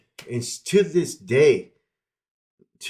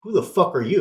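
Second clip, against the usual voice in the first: retold angry challenge, higher and louder; going off; 6 semitones higher, 5 dB louder than usual. Wife confronting the woman at the door, going off on her. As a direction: act out an outraged demand, raised voice. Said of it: "starts to go off on her".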